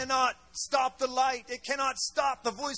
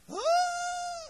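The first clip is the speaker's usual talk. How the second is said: sharp gasp. Retold or imitated. Retelling woman bursting into tears.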